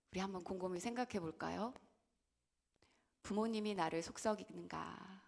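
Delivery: usual speaking voice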